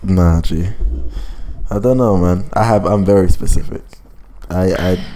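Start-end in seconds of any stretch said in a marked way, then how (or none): none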